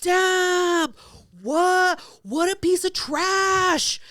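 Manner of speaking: high, loud shouted jeer, slow on trash; crowd jeer. Retold or imitated. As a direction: heckle like a bystander. Passers-by jeering at Pierre.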